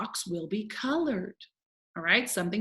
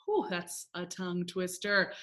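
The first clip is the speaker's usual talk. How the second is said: ordinary talk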